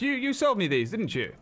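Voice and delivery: high, insistent merchant. Acted. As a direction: press her in a high squeaky voice. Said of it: "and says".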